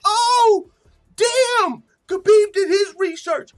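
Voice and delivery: very high, loud excited yell; shrieking amazed praise; hype shout. Real, not acted. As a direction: marvel at it at full volume. Hyped reaction.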